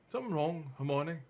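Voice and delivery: a hint of a worried schoolgirl; Hermione voice. Reader voicing Hermione.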